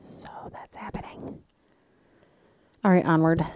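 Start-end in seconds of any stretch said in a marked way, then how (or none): 0.1-1.4 hushed ominous whisper; real hushed dread. Real, not acted. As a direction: whisper it like a dark secret. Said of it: "I feel it in my bones".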